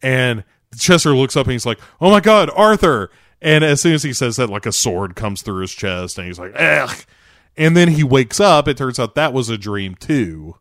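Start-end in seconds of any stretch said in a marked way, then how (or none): 2.0-3.1 high, loud startled exclamation; mock alarmed cry. Chester looking up at Arthur, alarmed cry. 6.6-6.9 loud, raised choked grunt; mock death groan. Chester as a sword comes through his chest, mock death grunt.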